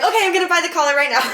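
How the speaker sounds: high, fast, gushing eagerness; eager excitement. Retold or imitated. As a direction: blurt it out, giddy and rushing. Eager reply retold to boyfriend.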